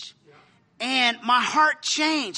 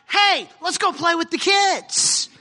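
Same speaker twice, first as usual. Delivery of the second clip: eager call; 6 semitones higher, 3 dB louder than usual. Retold or imitated. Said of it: "I was the one like".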